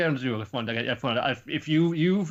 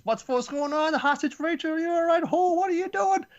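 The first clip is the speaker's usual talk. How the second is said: much higher, louder alarmed questions; frantic high voice; 5 dB louder than usual. Retold or imitated. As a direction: rush in panicking over the hostage. Bam arrives at the hostage scene.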